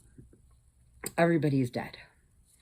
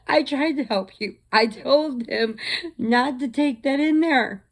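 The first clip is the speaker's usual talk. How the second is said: laughing taunt; 9 semitones higher, 6 dB louder than usual. Retold or imitated. Retells her taunting reply.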